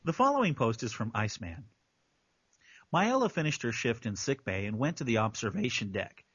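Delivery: low, measured narrator voice; story narration. Acted. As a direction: read it out as a composed narrator. Reads the post as narrator.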